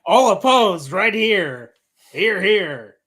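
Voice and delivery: loud, high mock-formal call; calling a vote.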